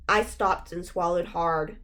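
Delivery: usual speaking voice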